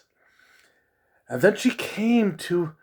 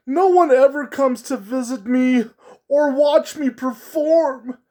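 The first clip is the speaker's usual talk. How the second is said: much higher, louder sad clown voice; a lonely clown pleading for company, up high; high clown voice, tearful at the end; 9 semitones higher, 5 dB louder than usual. Acted. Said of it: "said in his clown voice".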